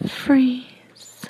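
usual speaking voice

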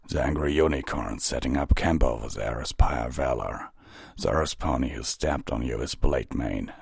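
low, measured captain's voice; composed captain. Acted. Captain introduces his squad to Twilight, low and composed.

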